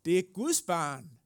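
put-on loud, high-pitched defence of a child; impassioned advocate. Advocate defending God's child from the accusers, loud and impassioned. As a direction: cry out the verdict with conviction. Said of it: "Og så siger han".

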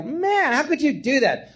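high indignant cry; hammed-up betrayed outrage; mock shocked scolding; outraged. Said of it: "I go".

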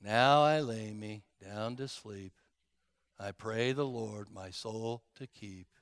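low, droopy Eeyore-style mumble; mopey Eeyore prayer. Retold or imitated. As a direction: pray like a gloomy donkey. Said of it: "our prayers are like Eeyore".

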